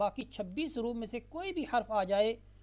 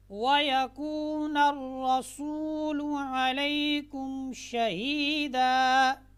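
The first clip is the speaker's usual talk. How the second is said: slow melodic chanted recitation, higher and louder; full recitation; 5 semitones higher, 6 dB louder, much slower than usual. Shown as a demonstration. Teacher recites the complete verse again for students. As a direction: recite the whole verse slowly in tones.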